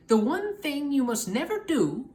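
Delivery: lightly voiced old witch; Stregonona. Stregonona's warning.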